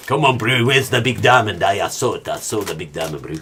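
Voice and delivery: a hammed-up Leo-in-Blood-Diamond South African accent; a deliberately terrible movie South African accent; bad South African accent. Imitating Leo in Blood Diamond hunting a diamond, bad South African accent. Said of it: "Leo in Blood Diamond".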